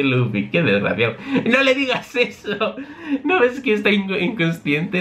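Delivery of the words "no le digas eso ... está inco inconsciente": much higher little scolding voice; scolding Chopper. Acted. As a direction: tell him off, half laughing.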